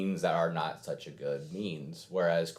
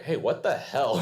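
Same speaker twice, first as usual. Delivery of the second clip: higher, louder challenge, hey what gives; acted-out outraged outburst; indignant Korra; 7 semitones higher, 5 dB louder than usual. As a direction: call them out, loud and affronted. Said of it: "and Korra's like".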